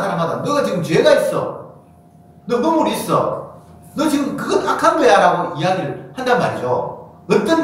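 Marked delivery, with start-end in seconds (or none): 0.4-5.2 high-pitched, pointed accusing; mock accusation. Preacher voices Jesus telling everyone he meets of their sin, accusing. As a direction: confront them with their guilt in a raised pitch.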